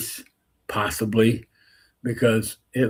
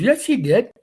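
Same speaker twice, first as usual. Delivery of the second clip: insistent retort; 12 semitones higher, 3 dB louder than usual. Retold or imitated. Coach insists in argument.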